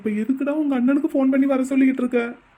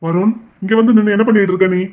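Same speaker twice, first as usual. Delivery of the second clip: angry demand; 3 semitones lower, 6 dB louder than usual. Acted. Father confronts son angrily.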